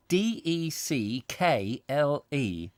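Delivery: slow, letter by letter; spelling it out. Shown as a demonstration. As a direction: spell each letter out deliberately. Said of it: "it is spelt".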